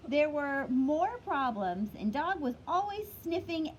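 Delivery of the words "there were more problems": ordinary delivery, nothing marked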